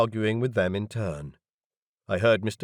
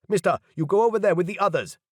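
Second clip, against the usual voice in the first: higher, louder, fast guard voice; crayfish leader ordering; 10 semitones higher, 5 dB louder, faster than usual. A narrator's reading. Crayfish leader ordering them to join the others. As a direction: bark the order at the strangers. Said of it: "the crayfish leader told us".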